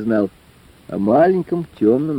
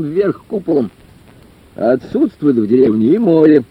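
raised, high-pitched lecturing voice; official-minded lecturer; 6 semitones higher, 3 dB louder than usual. A narrator's reading. Lecturer explains why the village experiment is impossible, declaiming. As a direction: explain it grandly, as to a public hall.

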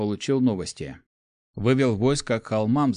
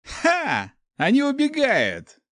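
much higher, gleeful cry; triumphant shout; slower than usual. A narrator's reading. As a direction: crow with delight as they flee. Rider sees barbarians flee.

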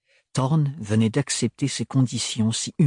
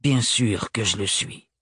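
ton cassant; 2 semitones lower than usual. A narrator's reading. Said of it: "d'un ton cassant".

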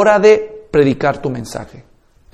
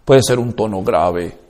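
low pitch; 10 semitones lower, 6 dB quieter than usual. Shown as a demonstration. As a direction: drop into a deep low voice. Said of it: "Varía la entonación".

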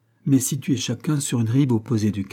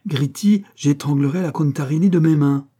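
higher, louder, with fury; jealous threat; 5 semitones higher, 6 dB louder than usual. Acted. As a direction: spit out the threat in jealous rage. Jealous courtesan threatens her rival.

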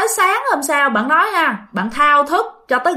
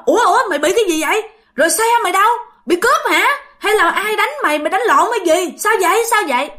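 high, rapid, worried questions; alarmed questioning; 6 semitones higher, faster than usual. Retold or imitated. Shopkeeper alarmed, quizzing the panicked man, high and rapid.

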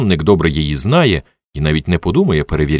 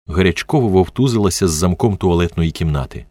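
plain reading voice

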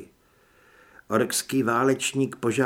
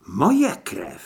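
usual speaking voice

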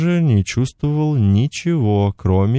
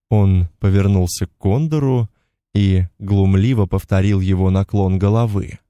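ordinary delivery

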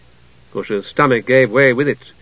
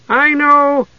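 high, loud eager cry; highly excited; 13 semitones higher, 4 dB louder than usual. A narrator's reading. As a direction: burst out with excitement. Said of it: "Tass cried, highly excited".

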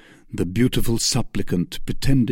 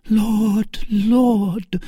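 high, meek plea to heaven; angelic meekness; 13 semitones higher than usual. A narrator's reading. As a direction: pray meekly, pitched well up. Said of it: "an angelic meekness of gesture and tone".

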